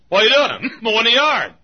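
incredulous shout. Pretend. Neighbors mocking pulpit-mowing.

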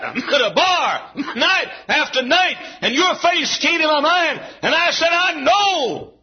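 fervent revival preaching. Acted. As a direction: build the sermon to a cry. Preaching at full force.

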